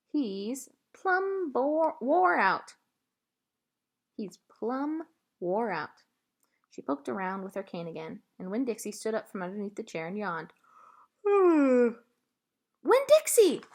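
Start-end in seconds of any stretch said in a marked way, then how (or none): 0.1-6.0 put-on slow old woman. A narrator's reading. Gloria drawls about the dog. 11.2-13.6 long, loud call of the dog's name; holler. A narrator's reading. Girl calling her lost dog, loud holler. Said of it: "I hollered".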